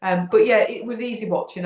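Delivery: usual speaking voice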